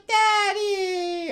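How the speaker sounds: very high, loud cry of delight; joyful cry. Retold or imitated. Flash greeting her father, high joyful cry. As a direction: call out like a happy child running home. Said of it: "she's like".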